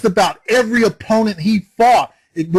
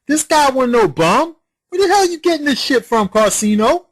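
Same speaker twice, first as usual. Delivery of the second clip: outraged disbelief; 7 semitones higher than usual. Real, not acted. Outraged at rival's claim.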